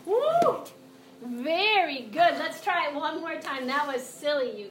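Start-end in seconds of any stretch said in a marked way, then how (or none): 0.0-0.6 high, loud cheer; excited woo. Acted. Teacher cheers the kids' frog jump, high whoop. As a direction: whoop for the kids.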